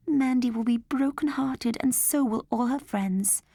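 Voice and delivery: worried mother. Acted. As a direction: fret like a worried mum.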